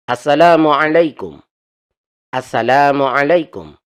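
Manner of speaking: loud demo greeting. Shown as a demonstration. Teacher calling out the greeting to students, loud and clear. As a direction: call the salam out to the class.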